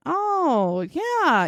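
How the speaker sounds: mock-interested, pitched well up; humoring along. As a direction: play along as a listening cop. Police humoring suspect to keep talking.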